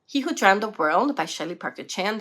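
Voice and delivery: ordinary talk, nothing marked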